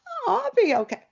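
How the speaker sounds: very high, loud, airy dismissal; breezy protest. Retold or imitated. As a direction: wave off the worry in a high voice. She brushes off brother's worry.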